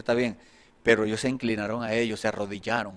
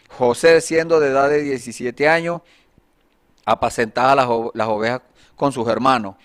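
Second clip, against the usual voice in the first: loud, projected Bible reading; declaimed verse with emphatic phrase openings; scripture reading; 7 dB louder than usual. Acted. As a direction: read the passage out firmly to a congregation. Reads Genesis passage aloud.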